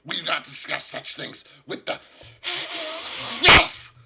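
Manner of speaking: high goblin voice rising to a furious screech; angry goblin. Acted. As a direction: spit out the last word like a goblin. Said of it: "he says".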